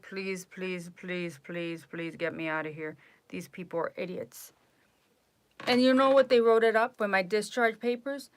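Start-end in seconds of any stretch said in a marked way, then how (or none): 0.0-4.5 low, soft begging under the breath; retold muted prayer to escape; inner plea. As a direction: plead silently to yourself, voice dropped. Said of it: "in my mind, I was like".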